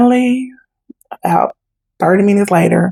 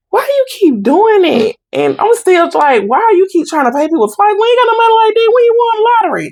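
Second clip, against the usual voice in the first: put-on much higher, sarcastic teen rant; exasperated scolding; 13 semitones higher than usual. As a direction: scold your mother for wasting money. Scolding mom over double paying.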